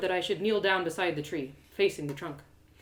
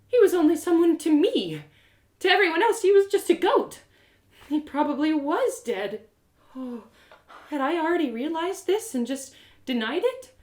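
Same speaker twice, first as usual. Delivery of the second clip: grief; 9 semitones higher, 6 dB louder than usual. A narrator's reading. Narrator's grief rising.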